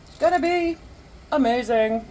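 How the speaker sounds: high, excited and loud. Real, not acted. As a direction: sell it with big excitement. Excited about the pickles.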